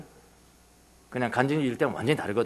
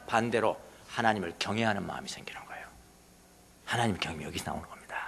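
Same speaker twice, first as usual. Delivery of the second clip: whisper; 7 semitones lower, 10 dB quieter than usual. Real, not acted. Whispered point on fear of God.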